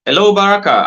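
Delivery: high, loud calling out; calling out loudly. Real, not acted. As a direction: shout to be heard down the line. Calling to a guest on a bad line.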